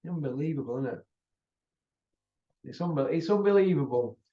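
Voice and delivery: it's unbelievable breathed out as a whisper; whispered disbelief. Real, not acted. Marvelling at the boxer's record, a whispered aside. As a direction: hush it in disbelief.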